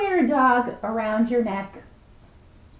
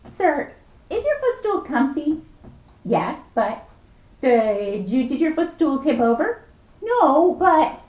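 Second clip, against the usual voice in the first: excited back-and-forth; 5 semitones higher, 6 dB louder than usual. Acted. Clerk and man argue quickly.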